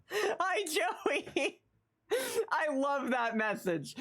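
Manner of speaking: high, laughing greeting. Real, not acted. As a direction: squeal it out between laughs. Greeting chatter, laughing hard.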